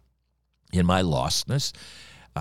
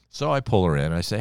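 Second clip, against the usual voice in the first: normal talking voice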